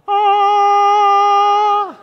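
high loud sung ah; a bright choir-like ah, high and loud; angel ah. Shown as a demonstration. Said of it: "and everyone will go".

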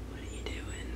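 voiceless, barely audible whisper; whispered reproach. Real, not acted. Rider questioning those only in it for ribbons, whispered. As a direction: whisper it after a long pause.

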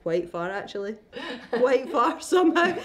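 wry laughing aside. Retold or imitated. I was thinking to myself.

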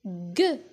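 G as the isolated hard sound "gue". Shown as a demonstration. Teacher repeating the sound for pupils, hard g. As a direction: say G as "gue", as in gâteau. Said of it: "Le son".

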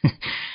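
short, soft sneering laugh; brief chilly snicker; quiet cold laugh; cold chuckle. Acted. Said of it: "冷冷一笑道".

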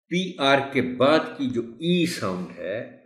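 ordinary talk, nothing marked

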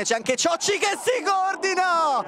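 high, slow shouted build-up; breathless italian goal commentary. Acted. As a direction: call the chance at full voice. Replayed commentary clip.

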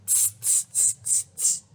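soft voiceless tss tss tss; tut-tut like a cheeky monkey; monkey tsk. Acted. Monkeys tutting back at the peddler, soft voiceless tss. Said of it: "And they said".

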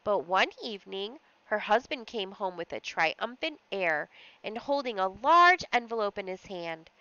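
plain reading voice

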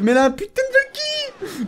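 very high, slow squeal of frustration; exasperated outburst. Real, not acted. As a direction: squeal the curse in outrage. Sudden setback, swears at the game.

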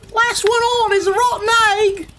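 high, cheeky chicken voice; teasing chicken. A narrator's reading. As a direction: taunt like a playful hen. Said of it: "what she thought was".

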